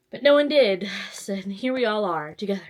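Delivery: usual speaking voice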